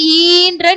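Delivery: "ஈன்ற" with the ஈ drawn out; long vowel. Shown as a demonstration. Teacher introducing the long sound, "ஈன்ற" with lengthened ஈ. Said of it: "நெடில் உச்சரிப்பு".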